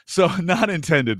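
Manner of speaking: high, loud, through real laughter; laughing. Real, not acted. Co-host excusing innuendo to the host, laughing. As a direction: laugh off an accidental double meaning.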